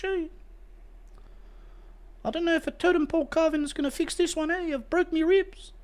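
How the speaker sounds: hammed-up New Zealander fading as he dies; New Zealand accent. Dying New Zealander apologising for his injuries, high comic accent. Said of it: "Imagine a New Zealander announcing to you like they're dead".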